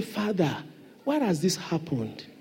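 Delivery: very soft, hushed question to God; a soft, earnest prayer; humble prayer. Shown as a demonstration. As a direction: pray it quietly in private. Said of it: "Go to him in closet, ask him".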